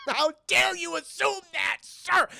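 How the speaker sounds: the doctor hams up his offence, shouting high and slow; mock outrage. Doctor shouts at the detectives in hammed-up outrage. Streamer repeats the outraged line.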